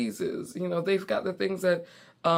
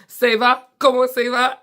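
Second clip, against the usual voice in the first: proud French; 6 semitones higher, 10 dB louder than usual. Shown as a demonstration. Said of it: "I'm using all my French words".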